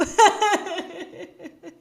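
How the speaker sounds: a high, breathy laugh through tears; teary laugh. Real, not acted. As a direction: laugh as the emotion spills over. Emotional laugh mid-story.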